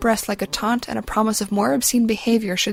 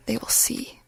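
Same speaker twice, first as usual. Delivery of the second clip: breathy hissed whisper; 9 dB quieter than usual. A narrator's reading. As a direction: whisper it to yourself. Villain's private whispered thought.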